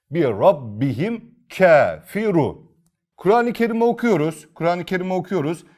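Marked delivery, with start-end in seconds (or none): 0.1-2.7 slow recited "Rabbihim kafiru", both ra heavy; thick ra recitation. Shown as a demonstration. Teacher reciting the whole example slowly. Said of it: "Yine kalın okuyoruz".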